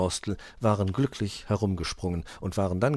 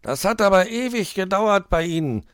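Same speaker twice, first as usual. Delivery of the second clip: high, loud irritated complaint; a fidgety fox fed up with waiting; impatient fox; 13 semitones higher, 9 dB louder than usual. A narrator's reading. Herr Fuchs scolds the late magpie, irritated. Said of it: "ganz kribbelig".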